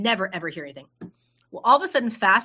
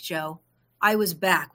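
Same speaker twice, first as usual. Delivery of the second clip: usual speaking voice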